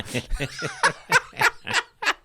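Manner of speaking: shrieking laugh up high; cracking up at his own joke; high squealing laugh. Real, not acted.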